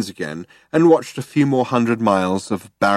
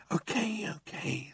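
very soft, raised pitch; placating; 12 semitones higher, 15 dB quieter than usual. A narrator's reading. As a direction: back down in a small, hushed voice. Ford placating shouting Zaphod.

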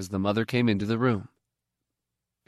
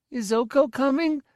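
put-on much higher small boy's voice; little child. Little Miv asks the mother, in a high child's voice. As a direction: ask it like a dazed toddler.

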